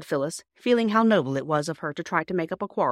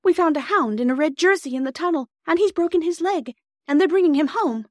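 put-on high, loud, breathless-child urgency; excited cry; 11 semitones higher, 6 dB louder than usual. Bobby telling Mother about the injured boy, excited high cry. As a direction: pour out urgent news excitedly. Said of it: "she cried".

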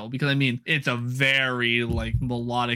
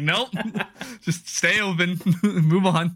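higher voice broken up by laughs; laughing mock command; 6 semitones higher, slower than usual. Podcaster joking to co-host about the curtains, laughing mock command. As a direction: laugh through a joking refusal.

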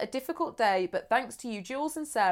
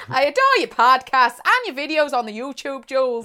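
a louder, over-the-top mock Irish accent; hammy Irish accent; 2 semitones higher, 7 dB louder than usual. Reading the fan's praise to Jules in a hammy Irish accent. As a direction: ham up the Irish accent on the YouTube. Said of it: "This accent is incredible".

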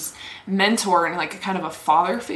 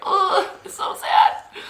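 slow, high, half-laughing whimper; mock sad whine; 12 semitones higher, much slower than usual. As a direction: whine it like you might cry. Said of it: "And you're just like".

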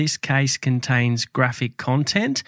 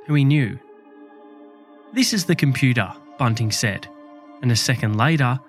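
true crime narrator. A narrator's reading. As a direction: tell it like a dark true crime tale.

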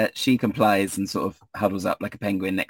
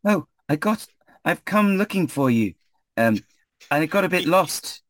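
high character voice; high-voiced lost wanderer; Ismark, sheepish traveller; 9 semitones higher than usual. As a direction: explain yourself in a high, put-on voice. Plays Ismark answering from roadside.

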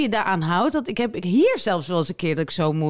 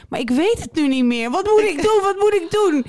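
high-pitched, louder flustered outburst; hammed-up frantic pleading, pitch jumping up; mock panic; 8 semitones higher, 4 dB louder than usual. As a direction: escalate into comic helpless panic. Acts out panicked texter's dilemma.

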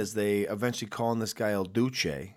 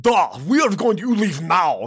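slow, louder mock Russian accent; bad Russian accent; 4 semitones higher, 5 dB louder than usual. Mocking the film's Russian guys in a bad Russian accent. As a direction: ham up a cheesy Russian accent. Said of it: "bad Russian accents one oh one".

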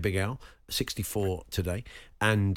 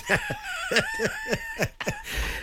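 high laugh. Real, not acted. Host cracks up at clip.

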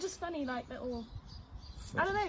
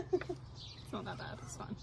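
whispered; 3 semitones lower, 5 dB quieter, slower than usual. Real, not acted. Hushed reassurance aside.

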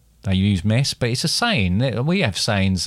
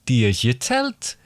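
Geordie accent; slower than usual. Shown as a demonstration. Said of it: "get into the character".

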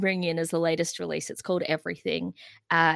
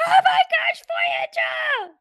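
very high, loud fan shriek; squealed starstruck shout; re-enacted yell; 6 dB louder, slower than usual. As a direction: imitate screaming at a band across the street. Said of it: "I stopped them by yelling at them".

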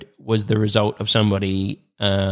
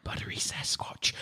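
whisper; 16 dB quieter than usual. Acted. Whispered dramatic monster name.

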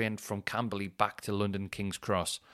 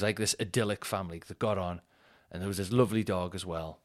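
normal talking voice

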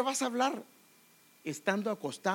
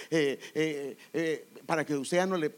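ordinary talk

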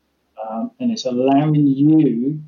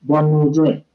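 "One more drink" echoed back with a rising, questioning tune; mirrored with upward inflection; 4 semitones higher, 4 dB louder than usual. Shown as a demonstration. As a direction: repeat "drink" so it lifts like a question. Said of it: "when you have an upward, um, inflection".

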